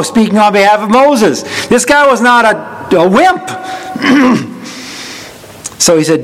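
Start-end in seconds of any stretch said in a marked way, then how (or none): none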